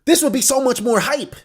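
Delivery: high-pitched, loud, worked-up protest; excited complaint. Real, not acted. Fan complaining to author about missing bounties, excited.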